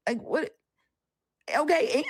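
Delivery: sassy clap-back to a believer in chat; defiant sass. Retold or imitated. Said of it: "I'm like".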